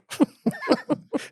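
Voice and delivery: high giggling laugh; real laugh. Real, not acted. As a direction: break up in high giggles at your own bit. Laughs after imagined interview.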